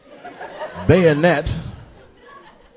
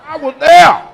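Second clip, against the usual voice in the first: high loud catchphrase; 11 semitones higher, 8 dB louder than usual. Acted. Catchphrase shouted.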